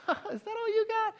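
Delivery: high-pitched jeering hyena voice; mock the little cub through high laughter; laughing taunt. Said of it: "the hyenas are laughing and saying".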